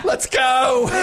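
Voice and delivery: high-pitched mock panic yell; urgent getaway shout. Diners rushing each other out, urgent mock shout. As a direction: holler it like a hammed-up getaway. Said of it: "trying to flee a crime scene".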